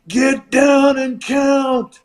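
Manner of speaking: slow, high, loud barked command; shouted order. Retold or imitated. Flair to the referee, slow loud high shout. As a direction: yell it at the ref, dragging each word.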